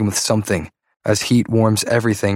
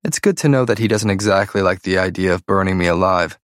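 plain reading voice

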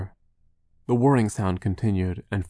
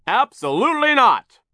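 high, loud bossy foreman; emphatic refusal; 9 dB louder than usual. Acted. Foreman refuses a longer lunch, shouting. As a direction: shut the request down with a shout.

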